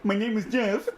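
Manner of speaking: high, repeated mock meme voice; ringtone meme loop. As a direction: chant it like a looping ringtone.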